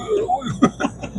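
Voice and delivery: a real quiet laugh, up high; soft high laugh. Real, not acted. Laughing after warning the cellmate off, a soft high real laugh.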